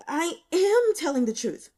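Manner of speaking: high and loud, pleading; insist, nervous and cornered; nervous insistence. Acted. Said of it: "Freshly nervous".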